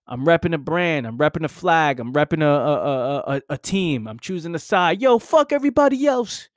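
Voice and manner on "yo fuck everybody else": higher and louder, a cocky yell; mock fan bravado. Young fan shouting about his team, hammed-up cocky yell. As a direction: hype yourself up like a diehard fan.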